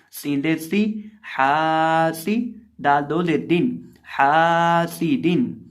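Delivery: हा सी दिन with हा stretched one alif long; slow drill, drawing out हा in हासिदिन; lengthened Ha. Shown as a demonstration. Teacher drills pupils on the long हा, slow recitation. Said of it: "हा को एक अलिफ के बराबर लंबा करके पढ़ेंगे".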